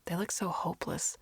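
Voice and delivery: plain reading voice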